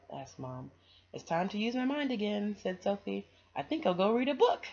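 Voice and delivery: put-on bright, lively reading to children; storybook read-aloud. A narrator's reading. Reader sharing a picture book with kids, lively storybook voice. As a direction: read it like bedtime story time.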